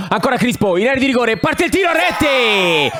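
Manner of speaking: rising toward a shouted shot call; excited commentary. Real, not acted. Shot on goal live.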